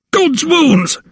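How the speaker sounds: very high-pitched shocked exclamation; aghast, high strained oath; horrified cry. A narrator's reading. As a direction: cry out in alarm, pitch leaping right up. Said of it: "he exclaimed".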